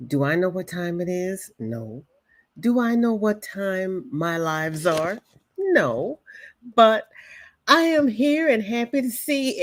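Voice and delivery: usual speaking voice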